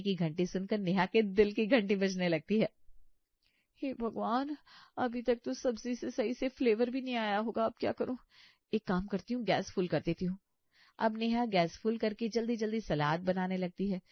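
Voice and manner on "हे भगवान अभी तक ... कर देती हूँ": fretting to herself under her breath; flustered young bahu. Acted. Neha panics in kitchen. (घबराई हुई बहू, धीमी ऊँची आवाज़)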